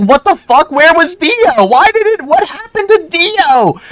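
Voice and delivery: wailing at the screen that his villain is missing; retold angry outburst. Telling friends how he raged at the missing villain, high and outraged. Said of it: "pissed off, dude".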